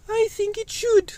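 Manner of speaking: very high, loud, squeaky puppet sidekick. Pretend. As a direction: pipe up as a cheeky little puppet. Puppet Grippy answers.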